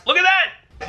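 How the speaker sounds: delighted. Real, not acted. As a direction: genuine delight breaking out. Delighted at a working result.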